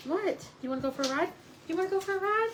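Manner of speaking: high, playful rising questions; enticing pet talk. Real, not acted. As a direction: get a dog worked up about an outing. Enticing the dog with a ride.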